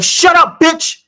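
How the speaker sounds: high, loud mock yell of shut up; shrieking at her man in the street, mocked; imitating a Karen yelling. Mimicking a woman yelling at her boyfriend, loud and high.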